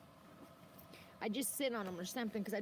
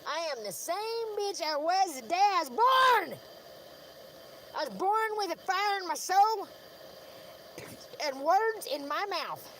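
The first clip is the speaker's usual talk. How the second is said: defiant declaration; 5 semitones higher, 5 dB louder than usual. Real, not acted.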